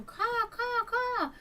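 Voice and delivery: high-pitched mimicked hawk calls; hawk caw imitation. Retelling the hawk that wouldn't stop, high caws. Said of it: "He kept on going".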